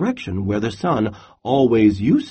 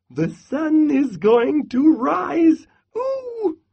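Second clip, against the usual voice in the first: much higher squeaky squirrel, squealing ooh; excited squirrel. Acted. Squirrel answers Bear, high with a squealing ooh. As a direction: chirp it out hopeful and eager.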